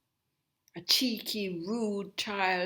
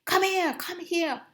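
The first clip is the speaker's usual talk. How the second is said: higher, louder mock summons; imitating mum calling her over sharply; mother calling; 11 semitones higher, 6 dB louder than usual. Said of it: "she would say".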